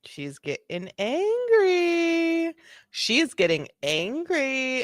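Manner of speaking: very high, drawn-out singsong; teasing, slow high-pitched commentary; mock alarm. Real, not acted. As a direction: stretch it out slowly, climbing high. Commentator narrating rising tension with relish.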